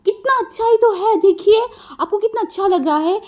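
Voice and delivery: mischievous kid pretending the painting is lovely; cheeky child voice. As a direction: play innocent with a laugh. Cheeky child defends painting laughing.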